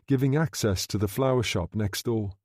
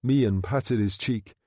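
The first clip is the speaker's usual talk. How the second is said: plain reading voice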